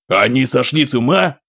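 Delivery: alarmed disbelief; panicked. Acted. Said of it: "вторил ему другой". (испуганный вопрос)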